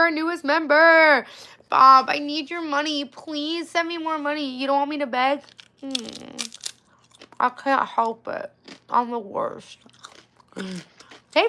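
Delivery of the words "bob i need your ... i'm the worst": mock begging. Begging Bob for money.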